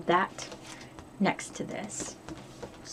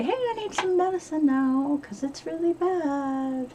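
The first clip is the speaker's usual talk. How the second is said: mock pleading patient, high then slowing; asking the doctor for medicine; 8 semitones higher than usual. Calling the GP to beg for headache medicine. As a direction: act out begging the GP for pills. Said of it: "the G P today to say".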